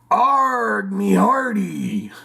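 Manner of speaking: high, slow pirate "Arg, me hearty"; hammed-up pirate cry. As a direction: ham it up like an old sea dog. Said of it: "What did the pirate say".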